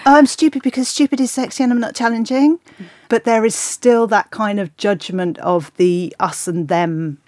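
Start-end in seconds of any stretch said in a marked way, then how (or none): 0.1-2.6 high, fast airhead voice. Retold or imitated. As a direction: play dumb and cute, quickly. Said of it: "doing the whole".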